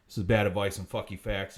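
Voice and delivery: low, steady show tagline; deadpan catchphrase. Acted. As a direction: announce the show's name.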